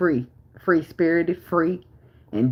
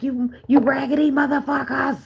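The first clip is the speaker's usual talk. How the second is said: high, exasperated mock scolding; mock exasperated mom; 11 semitones higher than usual. Mother fed up with her kids, high mock scolding. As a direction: squeak out a fed-up mom's insult. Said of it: "I be like".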